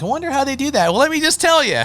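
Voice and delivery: a vendor playing shopper springs his sales pitch with a bright high lift; playful reveal. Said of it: "I'm like".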